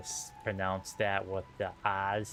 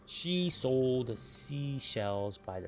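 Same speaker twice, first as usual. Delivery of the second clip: very slow, each word placed; careful tongue twister; 3 semitones higher, 3 dB quieter, much slower than usual. Shown as a demonstration. Friend dares the tongue twister, very slow and careful. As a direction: crawl through the tongue twister. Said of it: "Don't ask me to say those".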